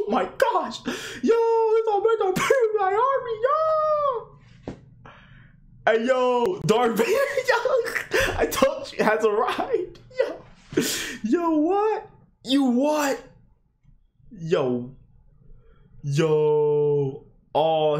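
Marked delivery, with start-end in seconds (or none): 0.1-0.9 high laughing gasp. Real, not acted. Laughing at the penguin army. 1.3-4.4 screaming way up high in pure hype; shrieking hype; excited scream. Real, not acted. 5.8-10.4 high, laughing yells; giggling excited cries; laughing hype. Real, not acted. Hyped laughing at Darth Vader. 11.2-13.2 stunned high yell; shocked yelp. Real, not acted. Shocked cry at screen.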